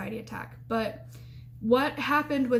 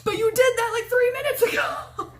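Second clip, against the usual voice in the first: high, loud squealed protest, breaking into laughter; incredulous protest; 12 semitones higher, 5 dB louder than usual. Retold or imitated. Student protesting to her roommate, high-pitched disbelief. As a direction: squeal it in disbelief, much higher. Retold upset reply to roommate.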